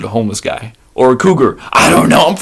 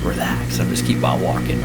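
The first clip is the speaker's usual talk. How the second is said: hushed self-calming after a scare; breathy calming whisper; 14 semitones lower, 8 dB quieter than usual. Retold or imitated. Whispering to himself to calm down on the trail. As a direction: whisper it to yourself, steadying.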